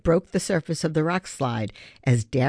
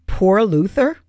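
higher and louder disbelief, rising on "Luther"; incredulous; 6 semitones higher, 7 dB louder than usual. Acted. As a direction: echo it back in shock, "Poor Luther?" climbing up. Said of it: "Ben couldn't believe his ears".

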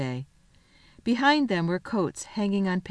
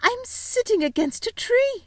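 very high, drawn-out amazement; astonished discovery. Acted. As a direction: voice the girl's startled discovery slowly. Susan discovers they are in woods.

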